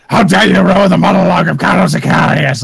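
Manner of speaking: indignant mock yell; imitating an outraged friend. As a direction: ham up a furious interruption. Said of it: "he gets us so fucking mad".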